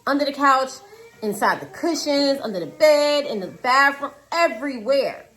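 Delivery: high, distressed storytelling; frantic retelling. Retold or imitated. Mom recounting a hunt for glasses, frantic.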